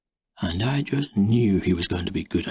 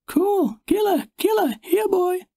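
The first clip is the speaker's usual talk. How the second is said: put-on much higher child's voice calling the dog; excited little boy. A narrator's reading. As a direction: call the puppy like a thrilled kid. Small boy calls the puppy.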